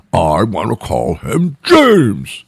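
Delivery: escalating name argument; insistent partner. Retold or imitated. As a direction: stretch out James defiantly. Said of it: "couldn't make a decision".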